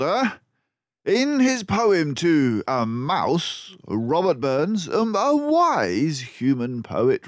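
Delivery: high, loud, slow voice; triumphant detective. Acted. Basil triumphantly lecturing the captured crooks. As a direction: savour the victory.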